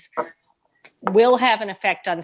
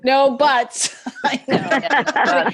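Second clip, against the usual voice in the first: higher-pitched, laughing interjection; real laughing; 5 semitones higher, much slower than usual. Real, not acted. Resident joking with neighbors about a no-butts sign, laughing. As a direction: say it through open laughter. Joining in a joke about signs.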